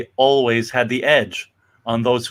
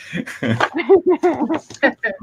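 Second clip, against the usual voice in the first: a high burst of laughter; 12 semitones higher than usual. Real, not acted. Host laughing high at a listener's comment.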